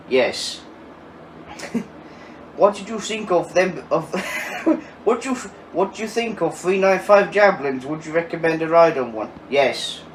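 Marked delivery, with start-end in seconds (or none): 2.5-7.9 put-on Yorkshire accent breaking into giggles; mock Yorkshire, slightly higher, laughing. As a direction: attempt a Yorkshire accent while trying not to laugh. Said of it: "students in Yorkshire accent".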